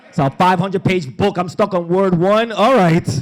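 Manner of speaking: loud, high comic exasperation; exasperated shout. Retold or imitated. Reader stuck on word one, exasperated shout. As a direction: raise the voice in overwhelmed protest.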